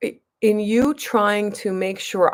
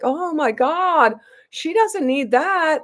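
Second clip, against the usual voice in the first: higher, slow, scandalised mock gasp; mock shocked nurse; 7 semitones higher, slower than usual. Imitating the nurses balking at her dose, mildly mocking. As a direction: lightly ham up the nurses' disbelief.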